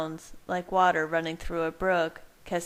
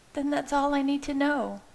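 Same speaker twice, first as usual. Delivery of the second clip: woman's voice; 9 semitones higher than usual. A narrator's reading. Bella answering.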